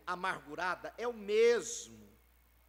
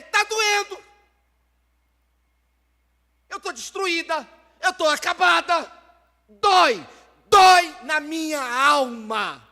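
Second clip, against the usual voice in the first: high, loud wail of pain; desperate shouted prayer; anguished cry; 5 semitones higher, 16 dB louder than usual. Acted. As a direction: cry out that it hurts, full force. Crying out to God in pain.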